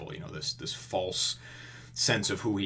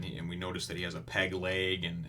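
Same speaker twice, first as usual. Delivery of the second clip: ordinary talk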